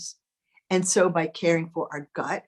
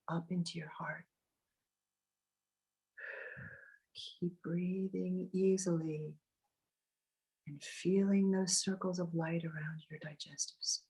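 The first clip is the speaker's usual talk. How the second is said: guided meditation; 13 dB quieter than usual. Acted. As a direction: ease them into calm, close and gentle. Leads a meditation.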